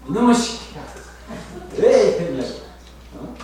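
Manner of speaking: mock angry mother yelling; a hint of a furious mother; scolding mother. Imitating a mother yelling at her hurt child, mock angry. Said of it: "어떤 어머니들을 보면".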